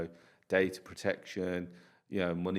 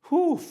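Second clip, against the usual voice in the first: high whistling whew; 11 dB louder than usual. Real, not acted. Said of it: "I'm telling you the tough part".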